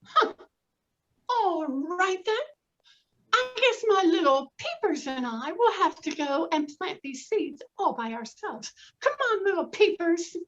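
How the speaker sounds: high, resolute hen voice; a chirpy hen who'll do it herself; determined hen. Acted. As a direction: brush it off and carry on. Hen resigned, plants alone.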